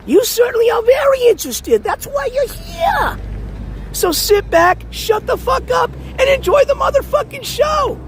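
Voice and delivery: high, shouted hype-man voice; hype announcer. Acted. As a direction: yell it like a show announcer. Hyping the stream to viewers.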